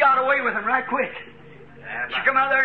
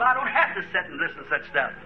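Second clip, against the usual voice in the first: mocking churchgoer; 6 semitones lower, 5 dB quieter, faster than usual.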